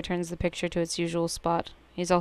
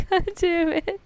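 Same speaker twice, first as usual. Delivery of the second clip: laughing; 12 semitones higher, slower than usual. Real, not acted. Laughing at the game.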